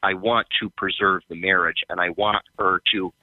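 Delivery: ordinary delivery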